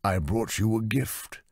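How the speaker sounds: a hint of a smug patron. Lord Dressen voiced.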